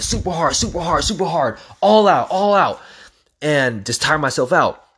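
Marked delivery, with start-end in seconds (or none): 0.0-2.8 all-out push. Retold or imitated. Pushing himself on ropes.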